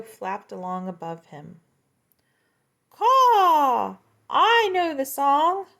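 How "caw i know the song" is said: very high, loud cawing; shrill mocking crow. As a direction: caw it out like a crow.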